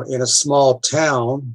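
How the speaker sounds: ordinary talk, nothing marked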